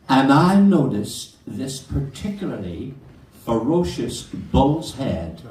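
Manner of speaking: ordinary talk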